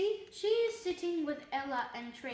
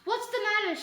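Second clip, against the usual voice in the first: raised, higher voice of concern; alarmed; 7 semitones higher, 6 dB louder, faster than usual. A narrator's reading. Mum rushing in to her screaming child, alarmed. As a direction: ask urgently, louder and higher.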